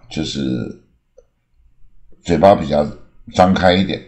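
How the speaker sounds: ordinary delivery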